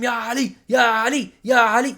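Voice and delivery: loud, high mock chant of Ya Ali; mocking scream. Mocking the host's claim, loud chanting. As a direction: shout it like a frenzied crowd.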